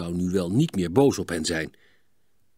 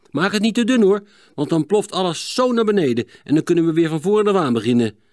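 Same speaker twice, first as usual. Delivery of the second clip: high, louder, fast warning; 8 semitones higher, 6 dB louder, faster than usual. A narrator's reading. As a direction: urge him not to dig too thin. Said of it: "waarschuwde Hielke". (waarschuwend en snel)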